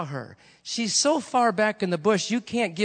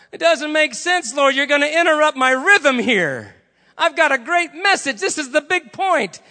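high, loud, indignant objection; loud protest; 9 semitones higher, 9 dB louder than usual. Acted. Evangelist interrupted mid-sermon, protesting loudly to the Lord. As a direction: argue back at full voice.

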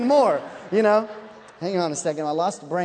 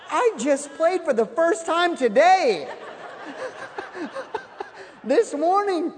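higher, louder, slow, laughing through it; laughing panic; 7 semitones higher, 3 dB louder, slower than usual. Retold or imitated. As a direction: protest while cracking up. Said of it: "I'm like".

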